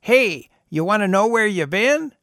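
spring it on him cheerfully; old man cheerfully offering to show John his past. A narrator's reading. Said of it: "a cheerful".

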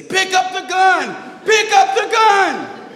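very high, drawn-out shout; chorus of yelling MPs. Retold or imitated. Chorus of MPs ordering the driver at gunpoint, screaming. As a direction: yell like a whole squad shouting orders. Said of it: "then his chorus of M Ps say".

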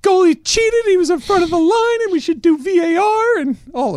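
put-on very high and loud, a wailing kid begging his mom; whiny crying child Messi. Messi wailing to his mom after missing a penalty, very high and loud. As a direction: whine it up high like a sobbing little boy. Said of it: "The best is the mom".

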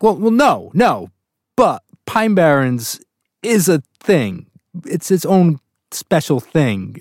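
retold protest, slowing right down for emphasis; emphatic protest. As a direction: insist on it, spacing the words out. Retold emphatic defence of favorite episode.

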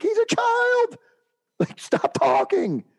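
very high, loud, slowed yell; exasperated yell. Retold or imitated. Coach shouting down the heckler, exasperated yell. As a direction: shout him down. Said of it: "And I'm like".

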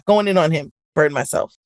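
normal talking voice

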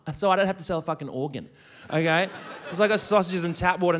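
normal talking voice

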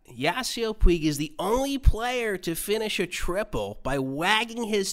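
high-pitched, drawn-out sportscaster caricature; squeaky, over-the-top mimicry of an excited commentator; Tim Kurkjian impersonation. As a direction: exaggerate a baseball analyst, very high and slow. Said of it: "the impersonation that I do of you".

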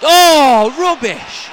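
high, loud Oh then rubbish; loud groan of disgust. Real, not acted. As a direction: despair at the wasted chance. Chance wasted.

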